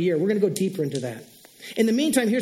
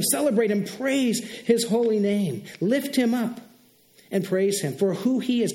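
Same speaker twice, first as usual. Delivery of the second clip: ordinary talk, nothing marked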